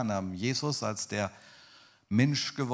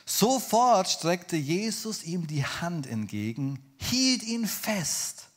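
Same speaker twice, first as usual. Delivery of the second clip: high, slow, emphatic Bible narration; loud, drawn-out proclamation; dramatic scripture reading; 7 semitones higher, 5 dB louder, much slower than usual. A narrator's reading. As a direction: proclaim the verse with stretched words.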